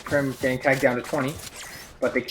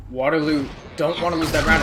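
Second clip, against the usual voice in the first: usual speaking voice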